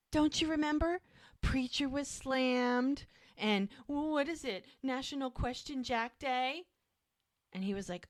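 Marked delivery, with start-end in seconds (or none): 0.1-6.6 higher, lightly put-on defensive voice; defensive reminder. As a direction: remind her, pitched up and a bit insistent. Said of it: "he goes".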